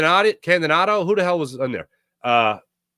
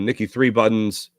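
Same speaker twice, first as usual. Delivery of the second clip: usual speaking voice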